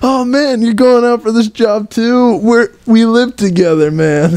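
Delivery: very high, dopey stoner voice; squeaky spaced-out stoner buddy. Pretend. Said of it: "his buddy shows up".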